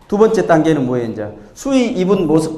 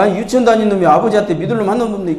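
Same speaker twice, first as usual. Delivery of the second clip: higher, fast mock indignation; indignant father; 4 semitones higher, much faster than usual. Father throwing his little son's words back, mock indignant. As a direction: rattle it back as an offended dad.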